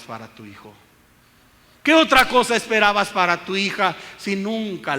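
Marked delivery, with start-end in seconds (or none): 1.8-5.0 louder, higher stress on "otra"; preacher's loud rebuke; loud reproach. Acted. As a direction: drive the blame home. Preacher scolds the mother.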